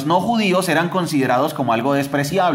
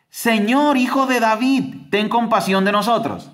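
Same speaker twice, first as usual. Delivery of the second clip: high pleading call, voice raised; retold crying out; 7 semitones higher than usual. Blind beggars call to Jesus by the road, high pleading cry. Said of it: "comenzaron a gritar".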